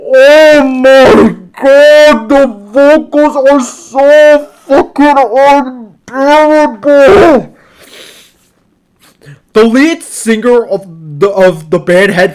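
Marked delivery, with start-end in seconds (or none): 0.1-7.5 loud, high, drawn-out wail of disgust; exasperated outburst. Real, not acted. Reviewer despairing over the album's vocals, exasperated and loud. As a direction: stretch every word out in agonized, raised exasperation. Ranting about dreadful vocals.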